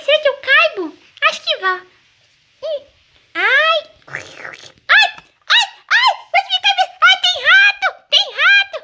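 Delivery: put-on high, bright little boy exploring, excited then startled; little boy squealing about rats in the stove; playful child voice. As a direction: play the curious kid discovering the stove.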